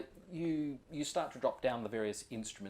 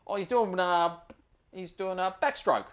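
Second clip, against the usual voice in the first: higher, louder easygoing Aussie ranger giving a tour; 6 semitones higher, 7 dB louder than usual. Acted. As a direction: play the laid-back outback ranger showing the spot. Ranger explains croc upside down.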